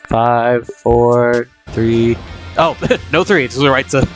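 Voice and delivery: ordinary talk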